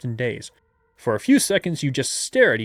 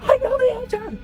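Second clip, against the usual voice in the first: high squeal of knowing the answer; excited yell; 3 dB louder than usual. Retold or imitated. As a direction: blurt it out, laughing. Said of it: "I like how you're just yelling this".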